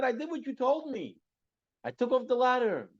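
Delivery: high-pitched acted self-defence; pleading protest. Stuck servant explains himself to his master, high protesting voice.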